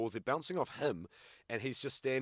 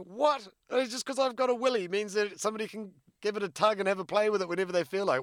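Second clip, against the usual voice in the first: high, loud, outraged squawk at the unfairness; a guy mocking himself, protesting being grabbed, high and loud; indignant mock voice; 11 semitones higher, 8 dB louder than usual. Scene: retelling his own protest to the other guy, high outraged mock voice. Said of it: "I'm like".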